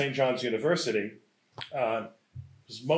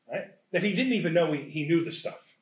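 ordinary talk, nothing marked

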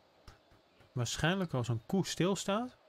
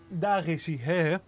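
ordinary delivery

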